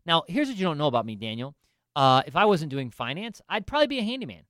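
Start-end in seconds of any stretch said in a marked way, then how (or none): none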